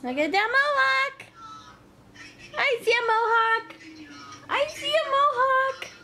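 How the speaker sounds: very high and loud delight; excited baby talk. Pretend. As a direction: squeal over the baby's hair. Excited baby talk about hair.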